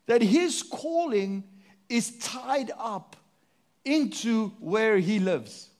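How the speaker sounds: slow, each phrase weighted and spaced; slow emphatic preaching. Real, not acted. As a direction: lean on every word, slowly. Preacher slows to drive point home.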